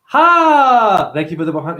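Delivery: a high, loud "Ha!" that drops to mock thanks; mock exclamation. Voicing someone dumping you, mock loud "Ha!". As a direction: burst out with a mocking laugh.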